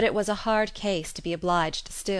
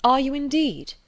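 higher, much louder eager question; eager cry; 8 semitones higher, 9 dB louder than usual. A narrator's reading. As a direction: burst out with sudden hope. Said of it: "looking eagerly at her".

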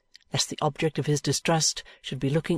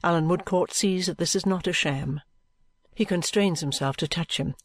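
plain reading voice

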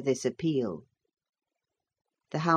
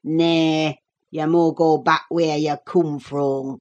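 gruff Yorkshire servant turning them away, louder; surly Yorkshire old man; 4 dB louder than usual. A narrator's reading. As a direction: dismiss them in broad Yorkshire dialect. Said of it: "screamed through his nose".

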